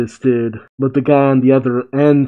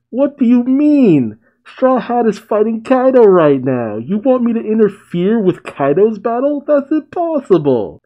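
much higher, panicked agent voice; alarmed agent; 9 semitones higher than usual. Acted. Bowler-hat agent protesting to his caller, high and panicked. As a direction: squeak it out in disbelief.

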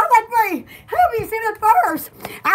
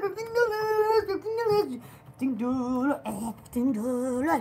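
muttered price; 6 semitones lower, 10 dB quieter than usual. Real, not acted.

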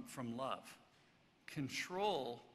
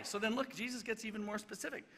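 usual speaking voice

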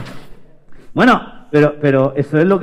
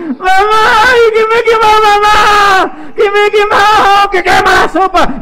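very high, loud crying shriek; hammed-up screaming tantrum over a burn; pained child's wail; 6 dB louder than usual. María screaming to mamá about burning soup, high wail. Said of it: "Y dijo".

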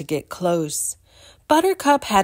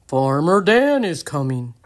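Mike the cow calls out, a bit louder; lightly voiced shout; 3 semitones lower, 4 dB louder than usual. Blind cow Mike announcing Farmer Dan, mild shout. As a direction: call out the news to the herd. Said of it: "he shouted".

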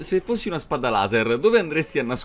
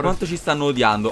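ordinary delivery

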